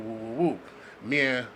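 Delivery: ordinary talk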